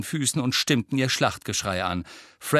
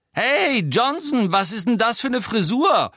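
very high, loud screech; screeching heckle; 12 semitones higher, 5 dB louder than usual. A narrator's reading. Pansy heckles Angelina about her hair, screeching heckle. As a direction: shriek mockery up from below. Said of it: "kreischte Pansy Parkinson von unten herauf".

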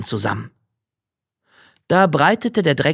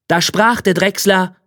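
usual speaking voice

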